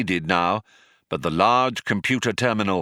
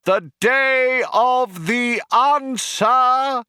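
high, loud, slow rally cry; cheerleader; 13 semitones higher, 4 dB louder, much slower than usual. A narrator's reading. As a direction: whip up the crowd over a tannoy. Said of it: "cried the cheerleader".

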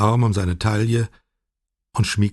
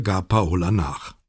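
ordinary delivery

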